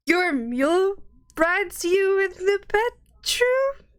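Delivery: horrified. Acted. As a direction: drag it out in disbelief. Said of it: "He looks horrified again".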